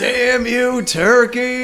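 mock vengeance cry. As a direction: curse the turkey in mock melodrama. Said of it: "he screams vengeance up to".

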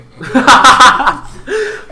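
big burst of laughter; loud laugh. Real, not acted.

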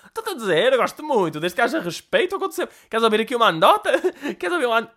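put-on high old lady voice, starting to laugh; granny cracking up before her joke; high mock-granny offering a joke; giggly old woman. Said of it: "tipo, já a rir-se".

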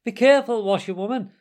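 loud, high cry of warning; warning call. A narrator's reading. Gentlemen to the speeding washerwoman, calling out warningly. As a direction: call out sharply to the driver. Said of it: "call out warningly".

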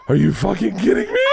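high, loud wail of disbelief; retold incredulous shout. Husband learning from wife who the teachers were, incredulous shout. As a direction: cry out in hammed-up horror.

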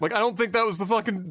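ordinary talk

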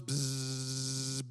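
slow drawn-out buzzing noise; imitated bee buzz.

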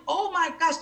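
very high-pitched retold dismay; exasperated disbelief. Patient about to start bawling, in exasperated disbelief. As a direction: react as if it's unbearable. Despairing reaction before bawling.

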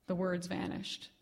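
ordinary delivery, nothing marked

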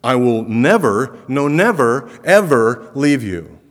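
forceful stress on every "never"; emphatic never. Shown as a demonstration. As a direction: drive home "never, no, never, ever". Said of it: "Do you think there's some emphasis here?".

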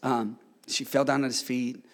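ordinary delivery

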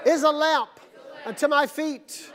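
high, projected phrases with pauses; call-and-response recitation. Shown as a demonstration. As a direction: lead a congregation's pledge, phrase by phrase. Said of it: "Repeat this after me".

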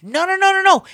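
rising indignant no-no-no; emphatic no. Retold or imitated. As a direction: insist he truly didn't hide it. Emphatic retelling of brother eating.

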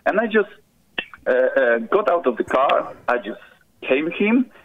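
ordinary talk

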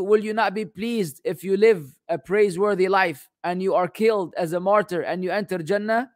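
ordinary talk, nothing marked

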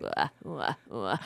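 creaky low fry sounds; low croaky vocal fry wahs. Shown as a demonstration. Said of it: "You make that sound".

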